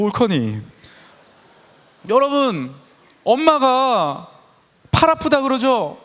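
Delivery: loud, fervent sermon voice pressing on the youth; impassioned revival preaching; preaching at full force. Acted.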